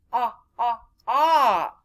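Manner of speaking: ah repeated as the short o vowel; teacher sounding out the vowel ah. Shown as a demonstration. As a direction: stretch out ah for the o in shop. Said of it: "shop would go with Oscar Orange".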